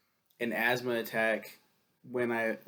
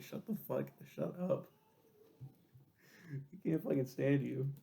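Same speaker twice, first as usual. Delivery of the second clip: mock annoyed friends; 3 semitones higher, 8 dB quieter than usual. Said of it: "Everyone's like".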